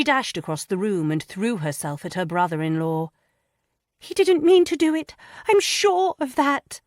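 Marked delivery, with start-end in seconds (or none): 4.0-6.8 very high, loud pleading cry; a young wife crying out for her husband; crying woman's plea. A narrator's reading. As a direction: beg through tears in a high woman's voice. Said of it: "she cried".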